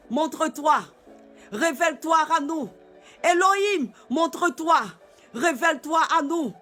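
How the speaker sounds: normal talking voice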